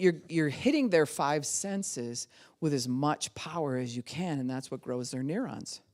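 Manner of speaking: ordinary delivery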